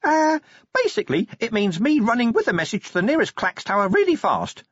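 high, eager imp voice; hopeful imp. Acted. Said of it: "said the imp hopefully".

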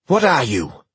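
loud, high yell. Acted. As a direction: shout into the dark house. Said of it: "he yelled".

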